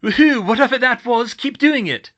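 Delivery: loud, high whoop of excitement; thrilled shouting; elated cheering. Acted. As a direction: holler encouragement over the chase. Cheering her spell mid-chase.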